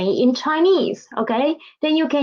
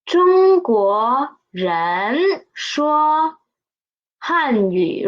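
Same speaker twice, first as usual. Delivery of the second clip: 中国人说 drawn out slowly; slow model answer; much slower than usual. Shown as a demonstration. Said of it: "It is".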